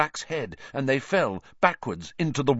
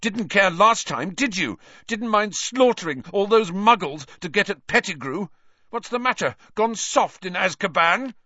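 higher, louder, bitter taunting; 9 semitones higher, 3 dB louder than usual. A narrator's reading. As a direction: jeer at him with contempt. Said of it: "Harry spat".

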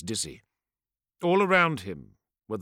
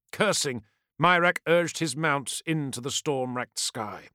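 ordinary delivery, nothing marked

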